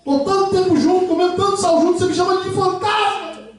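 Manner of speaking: high, indignant offended voice; offended spouse. Retold or imitated. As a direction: play the hurt, baffled spouse. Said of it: "Você vai falar assim".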